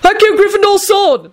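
very high-pitched yelled spell. A narrator's reading. Neville summoning the sword, yelling. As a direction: yell the summoning spell high, volume held in. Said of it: "Neville yelled".

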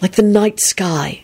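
slow, entranced sigh; dreamy, drawn-out wonder; lovestruck sigh. A narrator's reading. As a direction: breathe it out in a trance of love. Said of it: "he sighed".

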